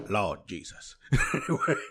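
words breaking into laughter; real leftover laughter through the words; laughing. Real, not acted. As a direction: try to move on while still giggling. Recovering from laughing fit.

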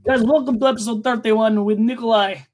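podcast host with a put-on Russian accent; hammy Russian show intro. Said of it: "full podcast in Russian accent".

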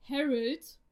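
a light warning tone; understated admonishing call. A narrator's reading. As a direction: caution him by name, mildly. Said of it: "Hannahs Stimme war mahnend".

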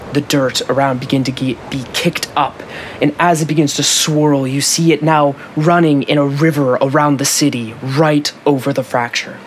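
steady scene-setting narrator; story narration. Acted. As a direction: paint the scene for the players. Game master's scene setting.

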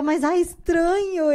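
slow, high, unsure drawl; hesitant self-quote; doubtful. Retold or imitated. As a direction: drag it out, unsure. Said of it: "E eu".

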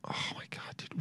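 very soft, voiceless groan of words; exasperated whisper. Real, not acted. Can't get the pronunciation to play, exasperated whisper. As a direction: whisper it under your breath in frustration. Frustrated at failing audio.

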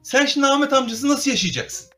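high, loud blunt question; loud tactless question. Retold or imitated. Wife voiced on condolence call.

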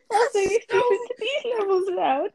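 high squeaky laughing words. Real, not acted. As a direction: talk through helpless laughter. Teasing friend, laughing.

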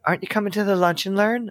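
high, quick chirpy coworker; sing-song nagging coworker. Retold or imitated. Mocking coworker.

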